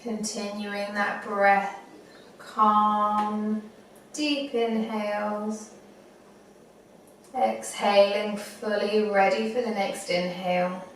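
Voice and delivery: unhurried meditation guide, long pauses; guided meditation. Acted. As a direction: talk them gently through it, phrase by phrase.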